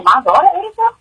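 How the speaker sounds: high-pitched stunned question, retold; disbelief. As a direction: ask it in rising, pained disbelief. Retelling her disbelieving questions at news.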